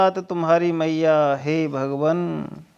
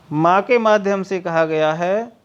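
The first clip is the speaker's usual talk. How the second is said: ordinary talk, nothing marked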